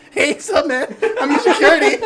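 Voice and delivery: loud, high mock swagger with a laugh; cocky customer. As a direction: ham up a customer bragging, laughing. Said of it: "They're like".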